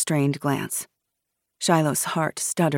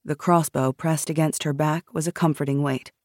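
ordinary delivery

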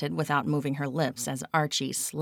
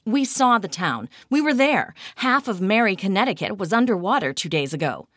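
loud, pressing protest; insistent angry retort; heated argument; 5 semitones higher, 6 dB louder than usual. A narrator's reading. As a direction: argue it hard, voice raised. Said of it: "Kat argued".

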